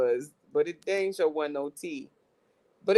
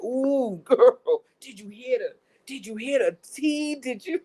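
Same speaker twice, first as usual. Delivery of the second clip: hammed-up excited gossip, higher pitched; mock gossip; 5 semitones higher, 4 dB louder than usual. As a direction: imitate eager gossips, breaking into laughs. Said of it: "they were like".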